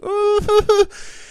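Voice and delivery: put-on high, girlish sobbing; hammed-up heartbroken blubber; mock ugly crying. Imitating himself as a girl just dumped, ugly sobbing. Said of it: "I'm an ugly crier. I am, like, sixteen-year-old girl".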